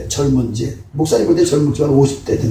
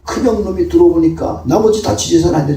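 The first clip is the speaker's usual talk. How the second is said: ordinary talk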